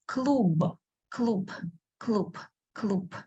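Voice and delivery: клуб with the final b said as a soft p; final B devoiced to P. Shown as a demonstration. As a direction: say клуб ending in a voiceless p. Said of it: "you do not hear clear B in the end".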